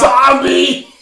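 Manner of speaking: loud startled shout. Real, not acted. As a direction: yell it out in surprise. Playing a zombie game live.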